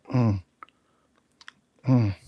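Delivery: soft mock groan of coming round; small slow "mm" of waking up; groggy moan. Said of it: "he, like, slowly, he's like".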